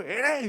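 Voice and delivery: high, drawn-out charging yell; mock battle cry. Peter swinging his sword wildly, mock battle cry.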